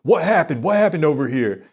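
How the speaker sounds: mock the instructor barging in; instructor's loud demand. Retelling the instructor's shout over the crowd. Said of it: "I hear the instructor".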